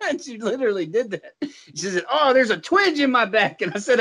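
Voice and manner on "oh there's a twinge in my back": higher-pitched mock complaint of back pain. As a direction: imitate a coworker caught by a twinge. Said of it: "This fucking woman's in pain over here".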